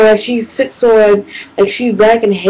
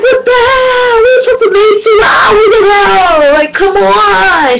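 exasperated whine; 10 semitones higher than usual. Retold or imitated. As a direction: cry out that it's way past time. Kid fed up waiting.

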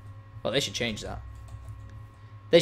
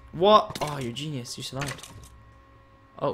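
normal talking voice

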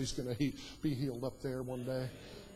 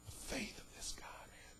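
awed whisper; 10 semitones higher, 15 dB quieter than usual. Retold or imitated. Onlookers' awed reaction.

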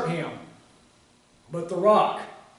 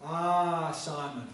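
rueful sigh; 3 semitones lower, 6 dB quieter, slower than usual. Real, not acted. Preacher laments Peter's denial after reading.

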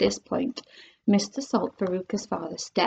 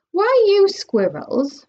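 pushy question; 9 semitones higher, slower than usual. Acted. Mike TV questioning.

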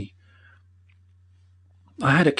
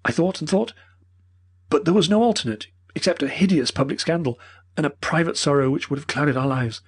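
put-on pleading old professor; 6 semitones higher than usual. A narrator's reading.

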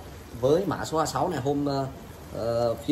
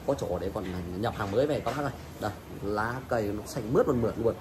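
ordinary talk, nothing marked

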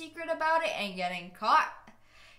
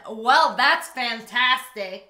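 sarcastic; 6 dB louder, slower than usual. Pretend. Mock dry reply.

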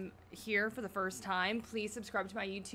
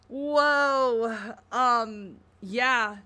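loud amazed whoa, louder throughout; excited whoa; 9 dB louder, much slower than usual. Real, not acted. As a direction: let the amazement burst out loud. Reacting to the reveal.

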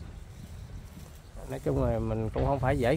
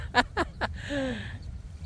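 high-pitched, tickled laughter; real laugh; 11 semitones higher, 4 dB quieter than usual. Real, not acted. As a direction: burst out laughing. Laughs at man's answer. (cười phá lên, cao giọng)

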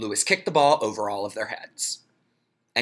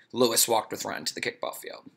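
usual speaking voice

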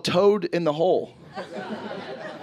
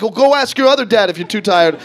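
loud, irritated brush-off; yelling Italian dad; 4 semitones higher, 7 dB louder than usual. Acted. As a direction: snap at your tired kid, raised voice. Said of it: "just getting yelled at by two Italian dads".

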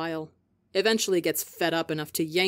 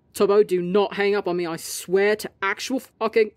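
angry; 2 semitones higher, 5 dB louder than usual. Acted. Angry friend being hung up on.

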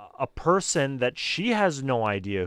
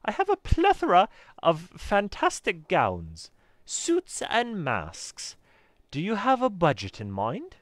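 high-pitched British shopkeeper; theatrical, put-on British saleswoman; attempted British accent; 7 semitones higher than usual. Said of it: "a British accent".

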